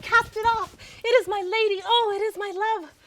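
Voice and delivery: high, loud cry of adoration; ecstatic outburst. Acted. Lover catching sight of the beloved at her window, ecstatic. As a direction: burst out as if overwhelmed by love.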